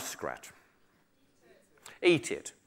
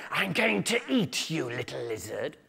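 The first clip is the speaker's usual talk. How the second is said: softer snake, sinking at the end; 6 dB quieter than usual. Acted. Snake to a little lizard, softer falling creature voice. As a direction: threaten softly, falling in pitch. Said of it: "a big snake came along".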